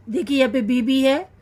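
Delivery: ordinary delivery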